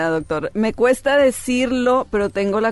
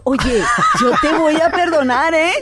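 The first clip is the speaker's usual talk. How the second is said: laughing mock warning; 6 semitones higher, 5 dB louder than usual. Mock line to her husband.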